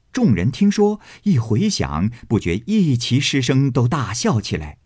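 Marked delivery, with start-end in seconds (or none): none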